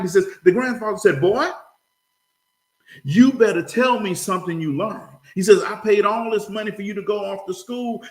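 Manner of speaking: high-pitched story with acted dialogue; animated joke telling. Grandfather demands to hear what boy learned, animated telling. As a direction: play out the back-and-forth, pitch lifted.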